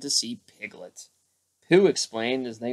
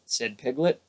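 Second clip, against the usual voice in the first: usual speaking voice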